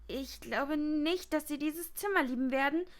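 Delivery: usual speaking voice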